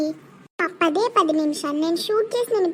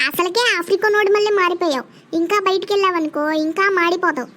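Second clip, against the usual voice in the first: ordinary talk, nothing marked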